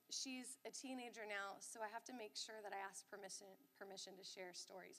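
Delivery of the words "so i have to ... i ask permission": ordinary talk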